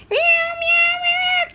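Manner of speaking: rapid, very high, loud meows; cat meows. Acted. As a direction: squeal a string of meows.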